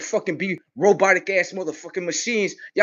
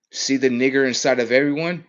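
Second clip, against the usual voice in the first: ordinary talk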